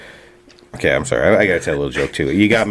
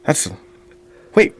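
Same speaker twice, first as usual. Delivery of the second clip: a hint of a startled patron; shocked bar guy; 9 semitones higher than usual. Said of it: "The guy goes".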